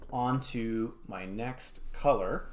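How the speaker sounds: usual speaking voice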